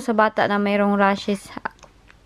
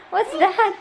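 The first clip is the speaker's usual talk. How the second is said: laughing; 7 semitones higher than usual. Real, not acted. Laughing with the playing child.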